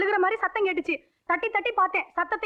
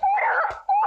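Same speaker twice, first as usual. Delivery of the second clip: much higher, sobbing yell driving him off; crying shout; 13 semitones higher than usual. Acted. Mother screaming at her disgraced son, crying. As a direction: scream him away through tears.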